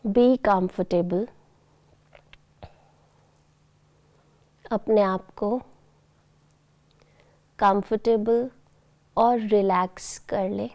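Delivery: soft, very slow meditation voice; guided meditation. Acted. Teacher guiding children into bedtime relaxation, soft, slow meditation voice. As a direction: ease them gently into relaxation, long pauses between phrases.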